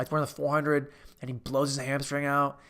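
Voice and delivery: ordinary talk, nothing marked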